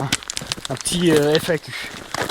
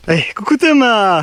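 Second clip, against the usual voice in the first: calling out; 7 semitones higher, 5 dB louder than usual. Real, not acted. Greeting friend coming up trail.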